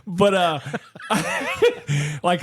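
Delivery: laughing through the words; chuckling while teasing. Real, not acted. Teasing his friend, laughing.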